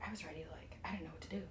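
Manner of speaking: whispered, no voice at all; hushed aside. Retold or imitated. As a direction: breathe it like a secret to a coworker. Manager's hushed aside retold.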